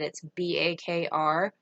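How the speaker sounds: usual speaking voice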